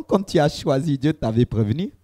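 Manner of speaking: ordinary talk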